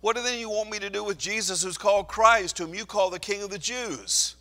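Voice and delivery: Pilate calling out. Acted. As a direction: call out over a crowd, pleading. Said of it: "called out to them again".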